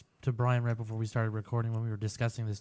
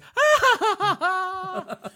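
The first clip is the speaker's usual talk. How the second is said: high, loud drawn-out mock wail; hammed-up high cry in staccato bursts; mock character cry; 5 dB louder than usual. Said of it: "And all you hear is".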